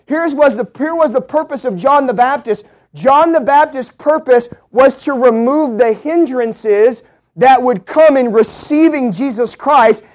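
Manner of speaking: loud, high, ringing sermon voice; preaching at full force. Acted. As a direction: proclaim it to the whole church. Preaching continues.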